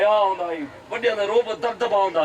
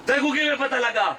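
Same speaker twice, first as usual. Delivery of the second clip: raised repeated question; 4 semitones higher than usual. Retold or imitated. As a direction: question repeated in a much higher preaching voice.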